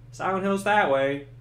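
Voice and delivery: hint of a different voice. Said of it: "he's like".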